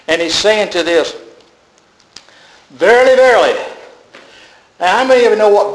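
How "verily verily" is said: ringing, louder declaration; solemn loud verily; proclaimed. Acted. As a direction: proclaim it like scripture. Preacher proclaims Jesus' words.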